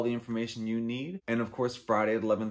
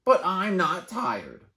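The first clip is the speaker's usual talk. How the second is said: high, slow stubborn whine; whiny protest; 8 semitones higher, 7 dB louder, slower than usual. Acted. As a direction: dig in and whine. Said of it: "says Bird".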